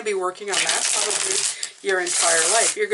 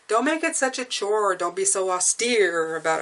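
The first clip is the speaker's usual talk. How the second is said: ordinary delivery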